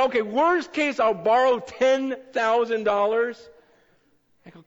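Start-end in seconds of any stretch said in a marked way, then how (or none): 0.0-3.4 excited replay. Retold or imitated. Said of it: "Remember last night when I said".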